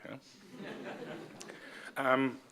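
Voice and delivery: ordinary talk, nothing marked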